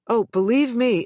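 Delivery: ordinary delivery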